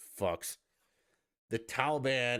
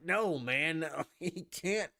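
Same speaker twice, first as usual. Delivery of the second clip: usual speaking voice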